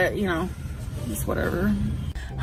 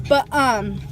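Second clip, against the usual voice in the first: normal talking voice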